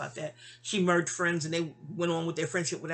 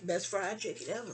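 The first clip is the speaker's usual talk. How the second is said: usual speaking voice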